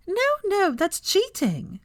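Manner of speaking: high, sharp protest, slow on cheating; snapping. Acted. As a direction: snap back at him, indignant. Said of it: "snapped Hermione".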